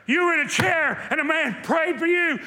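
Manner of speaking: man who drove six hundred miles cries out; desperate shout. Retold or imitated. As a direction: shout it as a man begging for freedom. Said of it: "he slammed it down, and he said".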